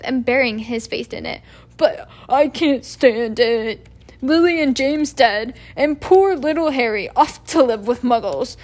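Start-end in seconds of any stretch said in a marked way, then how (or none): 1.8-8.6 sobbing lament. Acted. Hagrid grieving for Lily and James, sobbing loudly. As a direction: cry it out into the handkerchief. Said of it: "sobbed Hagrid".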